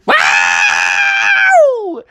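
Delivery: high, drawn-out cartoon wail; long high-pitched howl; yelp of pain. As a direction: yowl like someone just got zapped. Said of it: "all of a sudden they heard".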